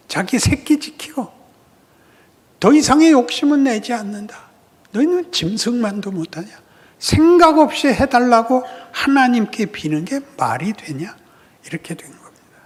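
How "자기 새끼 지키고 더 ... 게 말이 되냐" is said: loud, higher preaching rebuke; fiery preaching. Acted. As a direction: drive the point home with force. Preaching as God.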